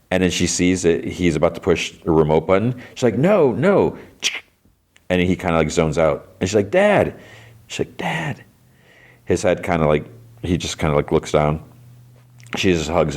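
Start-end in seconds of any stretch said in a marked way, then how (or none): 3.2-4.0 high-pitched retold cry of protest; alarmed plea. Daughter pleading as he reaches for button. 6.7-7.1 high, pleading call to a father; retold cry to snap him out of it; distressed call. Daughter calling to zoned-out father. 8.0-8.4 high, hushed plea to a father; softly retold call, pitched way up; distressed call. Second call to unresponsive father.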